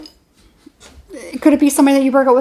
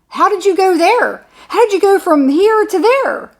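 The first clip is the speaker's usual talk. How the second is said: higher, slow incredulous question; how on earth did you get there; baffled disbelief; 6 semitones higher, 3 dB louder, slower than usual. Retold or imitated. Asking the one who misread a casual comment, baffled.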